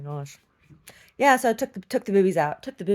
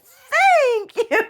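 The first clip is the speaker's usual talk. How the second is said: much higher, laughing thanks; mock gracious thanks; 10 dB louder than usual. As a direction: accept it like a diva. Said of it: "I'm like".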